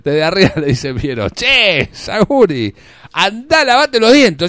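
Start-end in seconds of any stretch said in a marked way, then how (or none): 1.3-4.4 higher and louder, yelled down from the tree; shouted insult. Acted. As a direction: bark it down at him from above. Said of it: "lo mismo que le gritó". (gritado fuerte desde arriba del árbol)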